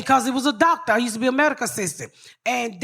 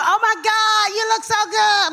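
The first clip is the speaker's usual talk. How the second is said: held-breath bougie talk; 9 semitones higher than usual. Retold or imitated. Mocking fake Hollywood people greeting you, held-breath bougie voice. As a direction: hold your breath and gush, pitched way up. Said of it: "That's how the people be in Hollywood".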